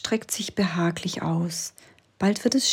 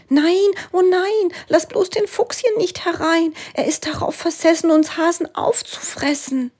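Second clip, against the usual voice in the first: much higher and louder, a panicked hare voice; a terrified little hare crying out against the fox; shrieking hare; 12 semitones higher, 6 dB louder than usual. A narrator's reading. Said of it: "Da schreit der Hase". (panisch schreiender Hase, sehr hoch)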